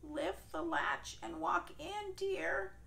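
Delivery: weak granny calling from bed; frail grandma. Acted. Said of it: "called Grandma".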